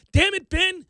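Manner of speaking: loud, high fan yell at the screen; frustrated fan barking at the TV. Retold or imitated. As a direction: shout at Ben through the television. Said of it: "I'm yelling at that".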